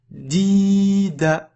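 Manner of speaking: "di, da" with long i, short final a; long then short. Shown as a demonstration. Teacher warning about endings, "di" held and "da" kept short. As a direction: hold "di", then cut "da" short. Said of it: "ne me fais pas long à la fin".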